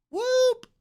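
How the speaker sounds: high whooping Aggie yell; a proud high whoop for his college team. Real, not acted. Streamer cheering for his college team, high whoop.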